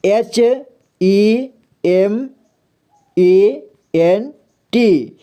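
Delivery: letters stretched very slowly; slow spelling. Shown as a demonstration. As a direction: spell it out for students. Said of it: "देखिए". (धीरे-धीरे अक्षर बोलना)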